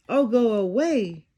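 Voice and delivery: a cranky mouse hidden in the shoe; small voice. Acted. Mouse grumbles from inside the shoe, small grumpy voice. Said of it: "said a small voice".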